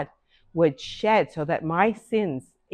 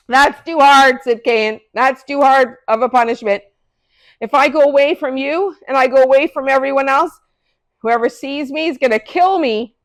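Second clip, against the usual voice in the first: loud complaint; 7 semitones higher, 8 dB louder than usual. Acted. Cain complaining about his punishment.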